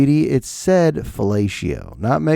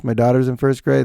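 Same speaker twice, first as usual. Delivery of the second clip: normal talking voice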